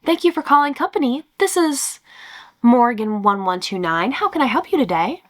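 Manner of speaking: bright, high, bubbly phone greeting; extra perky customer voice. Acted. Call center rep greeting a caller, high and perky. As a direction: sound like you love every caller. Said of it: "I put on my extra perky, we love the customer's voice".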